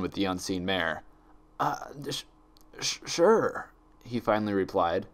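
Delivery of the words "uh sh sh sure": nervous stammer. A narrator's reading. Spike startled by the stranger.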